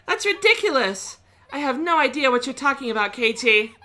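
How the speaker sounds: high, loud, through laughter; laughing denial. Acted. Edena brushing off Kei while laughing, mocking.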